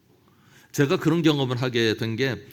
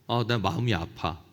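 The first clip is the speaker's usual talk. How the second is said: softer, sigh-like admission of hurt; gentle hurt; 6 semitones lower, 7 dB quieter than usual. Shown as a demonstration. Preacher modelling an I-statement, soft and hurt. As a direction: say it softly, owning the pain. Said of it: "이렇게 얘기를 하라는 거예요".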